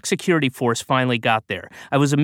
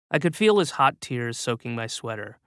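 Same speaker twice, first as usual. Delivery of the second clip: ordinary delivery, nothing marked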